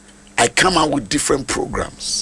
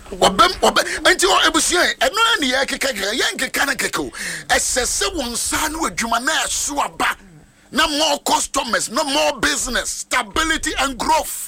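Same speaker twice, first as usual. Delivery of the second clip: high, driving preacher's voice; prophetic declaration; 10 semitones higher than usual. Real, not acted. Preacher declaring more customers and business, fervent prophetic preaching. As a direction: proclaim the blessing at a raised pitch.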